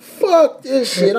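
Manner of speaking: slow wheezing laugh-cry. Real, not acted. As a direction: laugh until it cracks. Laughing so hard it cracks.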